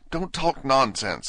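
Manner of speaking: Alice pushing back at the Dormouse; bolder. Acted. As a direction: stand up to him at last. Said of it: "said Alice more boldly".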